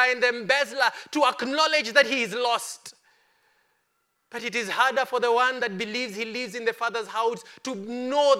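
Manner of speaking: loud, high, ringing preacher's cry; full-force preaching. Acted. Preacher to the congregation, booming full-force sermon. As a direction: preach it out to the whole church.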